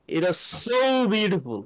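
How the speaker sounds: very slow, drawn-out It is so beautiful with rising and falling tune; excited intonation. Shown as a demonstration. Teacher modelling an excited English sentence, slow and sing-song. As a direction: savour each word like you're thrilled. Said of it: "দেখবেন এইভাবে ইয়া দেয়".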